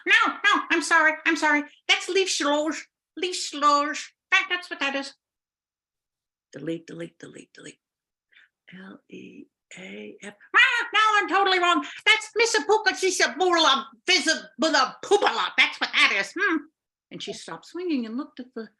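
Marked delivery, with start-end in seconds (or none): 0.1-5.1 high flustered witch voice. Acted. Witch corrects with nonsense name. 6.5-7.8 muttering under breath. Acted. Typing while muttering. 8.7-10.3 whispered spelling. Acted. Spelling while typing. 10.6-16.6 high witch voice. Acted. Witch offers another made-up name.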